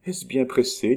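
high, lightly amused question; light amusement. A narrator's reading. False Nita brushing off the doctor, light amusement. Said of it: "eut le rire argentin".

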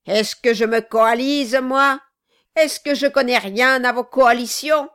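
loud, snarling outburst; furious dwarf. Acted. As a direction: turn on him with contempt. Quilp rounds on Brass.

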